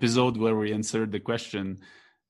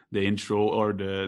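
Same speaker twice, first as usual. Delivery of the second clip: ordinary talk, nothing marked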